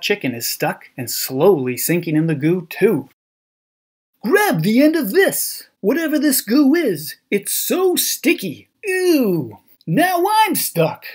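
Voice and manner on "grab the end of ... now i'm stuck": cartoon hero voice. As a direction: yell as you get stuck too. Egg Boy stuck in goo.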